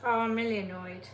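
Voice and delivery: normal talking voice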